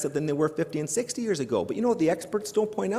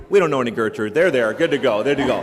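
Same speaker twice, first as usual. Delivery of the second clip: lower, louder cheeky refusal; mock family refusing; 6 semitones lower, 7 dB louder than usual. Parents at home turning down the collect call, lower and louder. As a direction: wink through the fake refusal.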